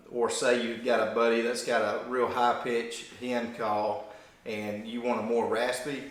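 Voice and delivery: ordinary talk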